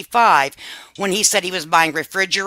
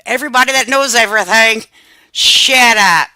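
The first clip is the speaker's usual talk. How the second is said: louder, rising to a mock-angry yell on "shut up"; mock telling off; 4 semitones higher, 9 dB louder than usual. Host telling off the commenters who complained, mock yell. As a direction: snap back at the know-it-alls, hammed-up.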